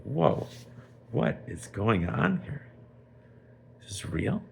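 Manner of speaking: acted disbelief, stretching the question; amazed king. King sees Shimon thrown into jail, amazed.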